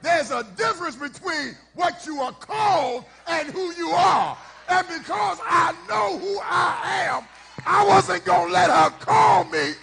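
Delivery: preaching at full force. Acted. Pastor shouting the point to the congregation, fervent. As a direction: whoop the message out to the church.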